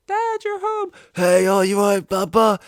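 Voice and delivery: put-on a little kid thrilled that daddy's home; excited child. A kid greeting dad home from work, high and squealing.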